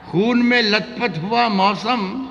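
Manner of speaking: high, slow declaimed verse; romantic shayari. Acted. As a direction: lift and stretch the line. Poet recites ghazal.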